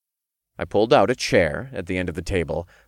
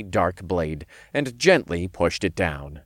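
ordinary delivery, nothing marked

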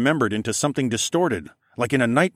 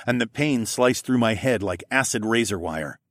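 usual speaking voice

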